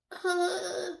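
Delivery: sudden high yelp of pain; pained cry. Real, not acted. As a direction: yelp as it hurts. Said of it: "that hurts for some reason".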